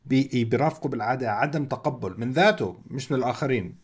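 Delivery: ordinary delivery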